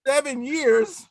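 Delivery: high-pitched incredulous protest, easing down by the end; indignant disbelief. Retold or imitated. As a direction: act out being outraged at the accusation. Acting out shocked reply to heckle.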